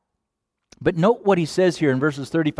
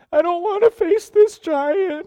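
whiny crybaby. Retold or imitated. Preacher mimicking a frightened believer, high mock crybaby whine. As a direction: play a scared child whimpering about the ball. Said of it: "like a whiny crybaby".

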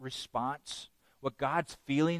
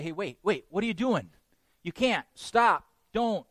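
urgent warning; 6 semitones higher than usual. Retold or imitated. People urgently warning someone.

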